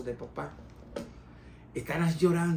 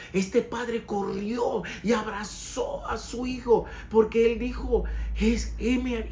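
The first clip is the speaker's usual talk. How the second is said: louder, higher preaching at full force; fervent preaching; 7 semitones higher, 5 dB louder than usual. Acted. A preacher proclaiming the father's welcome, fervent. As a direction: preach it with fire to the congregation.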